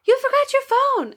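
high-pitched imitation of a young pupil; little kid. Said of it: "one of my little ones is like".

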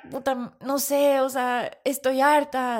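loud, slow, frustrated venting; fed up. Retold or imitated. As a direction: snap it out at the end of your rope. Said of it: "y yo como".

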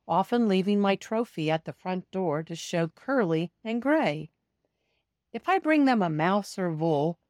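usual speaking voice

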